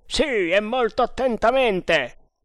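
high, loud, slowed emphatic reply; eager scout. Acted. Indian reporting to his sergeant, eager. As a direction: answer up high and firmly.

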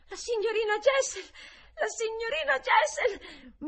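high, furious scream; loud, frenzied boy's cry; sudden fury. A narrator's reading. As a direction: shriek the name in a burst of rage. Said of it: "mi gridò con una furia improvvisa".

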